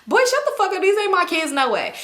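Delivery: high-pitched indignant comeback; indignant retort. Retold or imitated. Woman denying to boyfriend the kids are hers, indignant. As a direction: fire back, offended, pitch jumping up. Snapping back at his remark.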